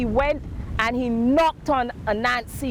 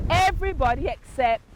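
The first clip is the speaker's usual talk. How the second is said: ordinary delivery, nothing marked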